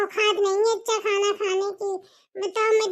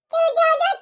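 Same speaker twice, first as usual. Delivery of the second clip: dismissive shooing; 7 semitones higher, 4 dB louder than usual. Acted. Mother-in-law dismisses daughter-in-law.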